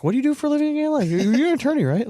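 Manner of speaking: high, mocking naive voice; mock dumb question. Teasing the bragging attorney with a high mock-innocent question.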